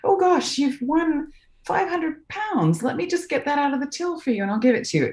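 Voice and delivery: shop clerk voice. Retold or imitated. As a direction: play the sweet shop man covering up. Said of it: "And he goes".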